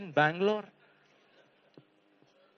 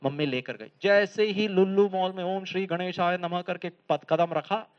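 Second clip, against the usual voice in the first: ordinary talk, nothing marked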